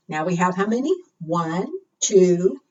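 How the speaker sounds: ordinary delivery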